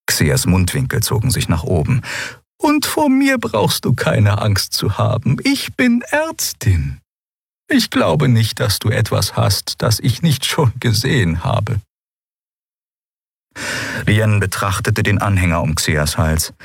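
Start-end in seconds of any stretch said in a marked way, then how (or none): 2.6-11.8 hohe, langsame Stimme der alten Draxyl; alte Ärztin, beruhigend. A narrator's reading. (hohe, langsame, beruhigende Stimme)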